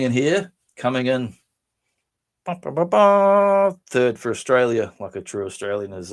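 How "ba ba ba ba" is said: sung trumpet fanfare, ba ba ba; vocal fanfare. Acted. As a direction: belt out a brassy fanfare. Fanfare sound before Australian entry.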